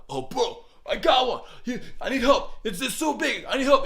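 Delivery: loud, high-pitched call for help; hammed-up thrill of a big catch; over-exaggerated excitement. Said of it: "gotta be exciting, over-exaggerated".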